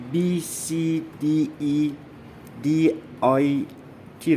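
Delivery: slow, letter-by-letter dictation; spelled out very slowly; spelling out slowly. Shown as a demonstration. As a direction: say each letter separately, drawn out, for someone typing along. Spelling out a command to type.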